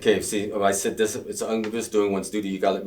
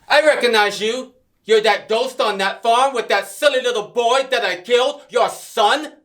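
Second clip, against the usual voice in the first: furious yell, high and loud; shouting; 10 dB louder than usual. Acted. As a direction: scream it at him across the room. Villain shouting at captive.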